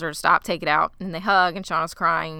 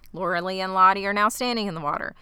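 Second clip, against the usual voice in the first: usual speaking voice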